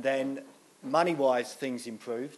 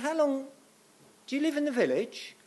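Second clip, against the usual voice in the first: much higher imitation of a nosy old lady; 13 semitones higher than usual. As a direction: pitch it up like an inquisitive pensioner. Old lady in shop voiced.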